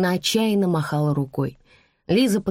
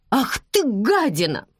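high, angry woman's outburst; enraged mother, pitch leaping then falling; furious insult; 6 semitones higher than usual. A narrator's reading. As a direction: spit out an insult at the child.

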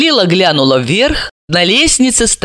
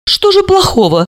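higher-pitched puzzled question; surprised; 7 semitones higher than usual. A narrator's reading. Guest questioning the upset mother, surprised. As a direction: ask in mild wonder. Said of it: "удивилась".

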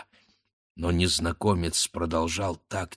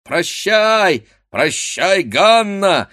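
high, loud, teasing shout; raised, ringing lad's shout; loud cry; 11 semitones higher, 8 dB louder, much slower than usual. A narrator's reading. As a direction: call out a mocking farewell. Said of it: "закричало несколько парубков".

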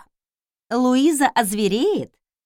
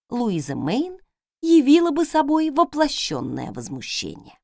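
ordinary delivery, nothing marked

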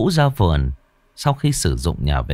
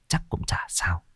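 whispered thought; 10 dB quieter than usual. A narrator's reading. Nhài talking herself into breaking the rule, whispered. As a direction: whisper it to yourself.

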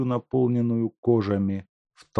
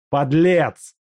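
angry insult; 7 semitones higher, 8 dB louder than usual. Acted. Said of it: "произнёс Степанов".

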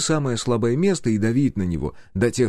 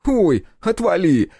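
much higher, louder, drawn-out brush-off; dismissive brush-off; 10 semitones higher, 5 dB louder, slower than usual. A narrator's reading. Step brushing off his friend's scolding. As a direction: wave him away with it. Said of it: "отмахнулся он".

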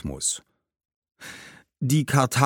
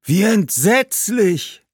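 high, loud, drawn-out ghostly exclamation; horrified cry; 12 semitones higher, 5 dB louder, much slower than usual. Acted. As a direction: cry out aghast, stretching the words. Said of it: "rief der Geist". (entsetzter, hoher, gedehnter Ausruf)

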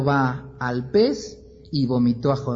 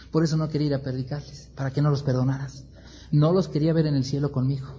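muttered grumble; 4 dB quieter, faster than usual. Acted. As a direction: rush through it under the breath. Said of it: "Jonás se enoja".

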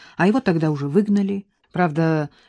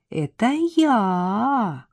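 slow, higher, teasing woman's voice; flirty sing-song; 4 semitones higher, much slower than usual. A narrator's reading. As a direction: drawl it out coquettishly. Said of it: "снова пропел кокетливый голос". (кокетливо пропеть, медленно и выше)

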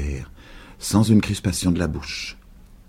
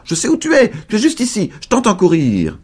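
high, louder cry; 4 dB louder than usual. A narrator's reading. Flushed old woman calling to invisible boy, high loud cry. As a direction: proclaim it triumphantly high. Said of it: "elle s'écria".

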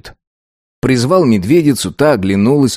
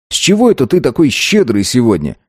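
usual speaking voice

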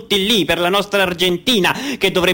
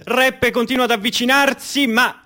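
higher, rising match commentary; live play-by-play; 6 semitones higher than usual. Acted. Commentator calling the final's goal, rising to a shout. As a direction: build to the goal call.

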